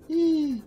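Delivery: high sudden frightened gasp; startled gasp. Real, not acted. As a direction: catch your breath in fear. Gasp as creature approaches.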